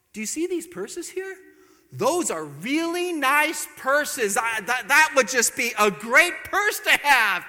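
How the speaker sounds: high, slow, dropping-a-hint voice; lingering over the purses; wife hinting about a purse; coy hinting wife. Retold or imitated. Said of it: "She goes".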